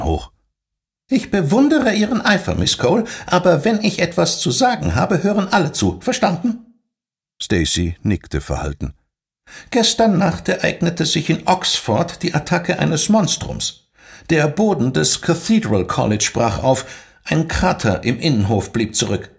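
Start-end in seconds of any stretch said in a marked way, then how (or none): 1.1-6.8 high, cool and formal rebuke; stern school head demanding silence; strict headmistress. Acted. Voices strict headmistress Miss Julia. 9.7-19.3 high, cool and formal rebuke; stern school head demanding silence; strict headmistress. Acted. Headmistress reports monster attack.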